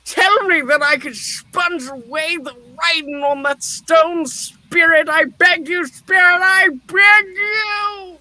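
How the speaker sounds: desperate shouted begging. Acted. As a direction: beg with everything you have left. Scrooge begs for change.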